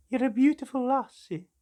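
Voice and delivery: high, loud, slow doting coo. Pretend. Collector coos loudly at his new figure. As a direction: gush over a toy like a baby.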